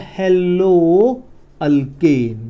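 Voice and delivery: very slow, long drawn vowels; slow dictation. Shown as a demonstration. Teacher giving students the word to use, slow dictation. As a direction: stretch both words at writing speed.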